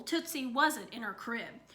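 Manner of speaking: usual speaking voice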